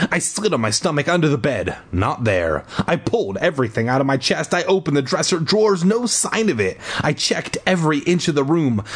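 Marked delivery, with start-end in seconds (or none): none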